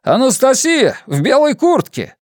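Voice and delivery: high loud shout over the crowd; calling out. A narrator's reading. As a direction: yell it to be heard far off. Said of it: "продолжал взывать мужчина".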